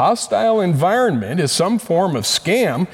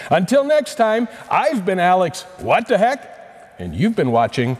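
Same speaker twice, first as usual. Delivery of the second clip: big game show sign-off; booming prize announcer; 3 semitones higher than usual. Acted. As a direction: stretch out the show title slowly. Host signs off the show.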